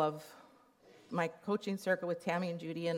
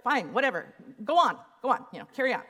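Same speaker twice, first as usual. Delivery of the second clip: grudging give-in; 5 semitones higher, 6 dB louder, faster than usual. Retold or imitated. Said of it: "I'm like".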